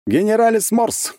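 slow, high, ceremonious pronouncement; solemn. A narrator's reading. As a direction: intone it like a ritual. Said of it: "торжественно произнёс".

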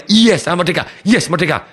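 triumphant declaration. Retold or imitated. Client is made to declare freedom, triumphant cry. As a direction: declare it like a coached victory chant. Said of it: "saya suruh teriak".

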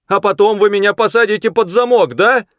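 high, loud suspicious question; shouted challenge. A narrator's reading. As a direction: call out warily over a distance. Boy shouts back suspiciously. (недоверчивый высокий крик)

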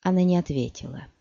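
ordinary delivery, nothing marked

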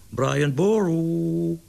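high, long-drawn sing-out with the last syllable held; luring call. A narrator's reading. Larry luring his pigeon down, cooing its name. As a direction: coo the name out slowly to lure a bird. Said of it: "begon hij hem te lokken met".

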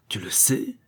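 eager question, rising at the end; understated ferocious delight; fierce joy. A narrator's reading. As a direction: press for confirmation with savage hope. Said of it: "avec une joie féroce".

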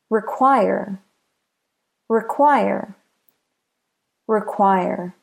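"Require" with QUIRE stressed; stress on last syllable. Shown as a demonstration. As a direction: say "Require" with a light RE, beat on QUIRE. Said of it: "The last syllable is stressed".

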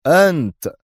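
loud, high one-word strike; severe, punched answer; stern accusation. A narrator's reading. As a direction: accuse him point-blank. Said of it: "والوزير يجيب في صرامة".

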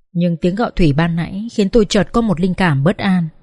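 plain reading voice

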